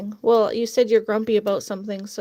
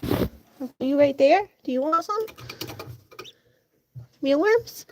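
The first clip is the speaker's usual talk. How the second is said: coaxing pet voice; 4 semitones higher, slower than usual. Real, not acted. Coaxing chickens, higher.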